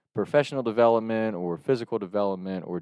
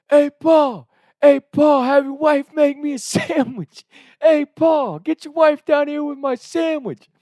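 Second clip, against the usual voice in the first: high, loud, slow cheeky calls; taunting yell; 8 dB louder, slower than usual. Retold or imitated. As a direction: keep taunting dad with a loud singsong. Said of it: "they do it".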